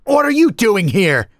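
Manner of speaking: high, loud challenge; shouting. A narrator's reading. Narrator splashing toward the Stick Man, loud high shout. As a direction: shout the demand across a distance. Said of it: "I shouted".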